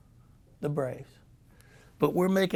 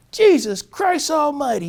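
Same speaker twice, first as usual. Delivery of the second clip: shouted high curse; loud, high furious oath; 11 semitones higher, 12 dB louder than usual. Retold or imitated. Manager cursing at his players, loud and high. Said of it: "He says".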